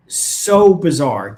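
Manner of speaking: so bizarre, drawn out very slowly; stress on so bizarre. Real, not acted. Host telling co-host the outburst was bizarre, slow stressed disbelief.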